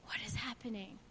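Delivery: hushed, near-whispered question; very soft, low plea; asking God. Retold or imitated. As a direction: barely voice it, a bewildered prayer. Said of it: "I had to sit and ask God".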